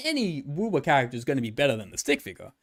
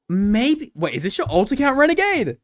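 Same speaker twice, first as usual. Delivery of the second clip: louder and higher, catching someone out; excited realization; 8 semitones higher, 5 dB louder than usual. Real, not acted. Streamer exposes a hater's alt account, excited. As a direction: pounce on the discovery, delighted.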